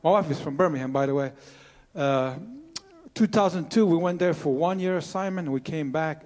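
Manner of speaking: ordinary talk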